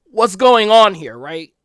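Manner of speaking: high and loud insistent appeal; urgent loud plea; pleading complaint to God. Retold or imitated. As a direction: press God to admit it. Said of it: "I would tell God".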